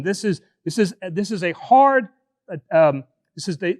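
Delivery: ordinary delivery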